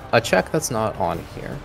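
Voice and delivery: ordinary talk, nothing marked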